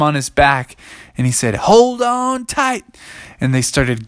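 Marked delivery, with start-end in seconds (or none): 1.5-2.8 slow, high holler; drawn-out, high dinosaur call; called-out warning. Acted. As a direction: call it out to riders on your back. T-Rex warns riders before galloping.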